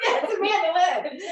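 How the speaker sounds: name drawled out in a Southern accent, laughing. Real, not acted. Reading a winner's name, Southern accent slips out laughing. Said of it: "It's my Southern accent that comes out".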